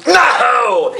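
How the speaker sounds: high, stretched-out cry; big acted yell; dramatic shout. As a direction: bellow it like a melodramatic villain. Captain cries out a command.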